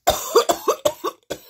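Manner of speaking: high, loud strangled cough; a sharp, desperate hacking cough; coughing fit. Real, not acted. Reader begging for a minute, loud choking cough. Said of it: "Give me a minute, please".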